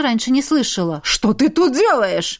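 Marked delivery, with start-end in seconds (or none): 1.0-2.4 слегка гнусавый высокий голос. Acted. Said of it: "высокий и слегка гнусавый голос".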